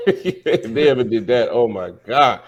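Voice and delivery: laughing as the words come out. Real, not acted. Co-host cracking up at the deployment joke, laughing. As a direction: talk through a burst of laughter.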